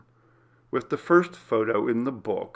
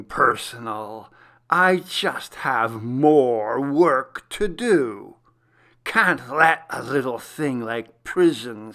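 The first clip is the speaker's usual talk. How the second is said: slow, louder, gloating villain voice; menacing killer's letter; 5 dB louder, slower than usual. Acted. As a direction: savour every word like a taunting stalker. Villain's letter read aloud.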